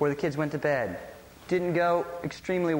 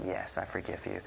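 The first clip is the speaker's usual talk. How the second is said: very soft, low retold reply; quiet forgiveness; 11 semitones lower, 11 dB quieter than usual. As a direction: voice the forgiving answer very gently.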